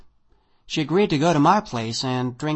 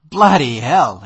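loud, overdone exclamation; host mock-amazed at the narrator's writing; exaggerated tone; 11 dB louder, slower than usual. A narrator's reading. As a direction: ham up the astonishment. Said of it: "exclaimed Sacadin Mikhailovich in an exaggerated tone".

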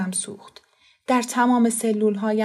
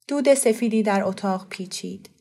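ordinary delivery, nothing marked

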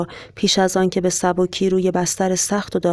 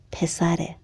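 softly whispered secret; hushed; 5 dB quieter, slower than usual. A narrator's reading. Joe shares his big secret with the conductor, hushed. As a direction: confide it in a near whisper. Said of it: "آهسته گفت".

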